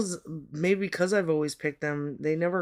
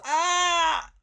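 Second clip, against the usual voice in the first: pained yelp; 11 semitones higher than usual. Real, not acted. Pain during clipping.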